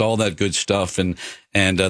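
usual speaking voice

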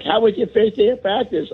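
high, sweet mom voice. Retold or imitated. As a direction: ask like a cheery mom. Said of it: "my mom's like".

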